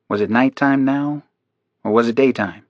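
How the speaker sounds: plain reading voice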